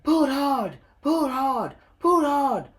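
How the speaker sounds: high, straining puffs; puffing with effort. A narrator's reading. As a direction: heave with each word. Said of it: "puffed Edward".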